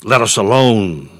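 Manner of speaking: mock cry with a long, falling last word; demon crying out. Demon to Jesus as he taught, imitated crying out. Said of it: "He cried out".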